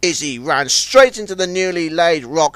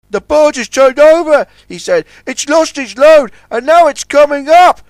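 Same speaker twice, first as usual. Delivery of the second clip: high, loud urgent report; 10 semitones higher, 7 dB louder than usual. Acted. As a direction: warn them quickly.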